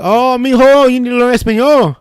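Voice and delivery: high, loud mock coworker scolding. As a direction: gently scold the kid about his Spanish.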